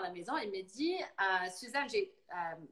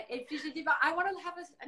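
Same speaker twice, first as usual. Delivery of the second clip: ordinary delivery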